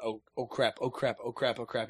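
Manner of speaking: hushed, low string of panicked repeats; retold nervous panic. Player replaying his panic while hiding, hushed and nervous. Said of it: "I'm like".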